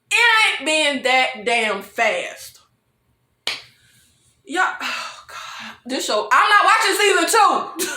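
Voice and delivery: usual speaking voice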